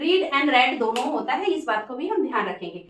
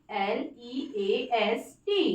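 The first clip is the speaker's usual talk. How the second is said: teacher spelling L E A S T slowly; 3 semitones lower, 6 dB quieter, much slower than usual. Shown as a demonstration. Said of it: "list".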